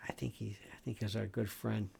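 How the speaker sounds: whispered aside about a friend; hushed aside. Real, not acted. Host recalls late colleague, hushed.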